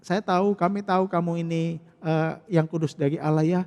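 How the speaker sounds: butter him up to soften his heart; fawning flattery. Acted. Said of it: "untuk melunakkan hati Yesus".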